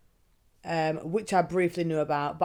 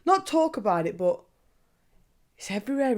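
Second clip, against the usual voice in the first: usual speaking voice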